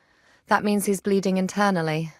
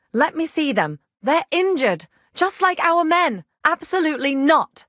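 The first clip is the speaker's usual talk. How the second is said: raised, higher furious scolding; angry shouting; 5 semitones higher, 4 dB louder than usual. Acted. Scolding the guards about the wounded prisoners, shouting angrily. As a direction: tear into them at full voice.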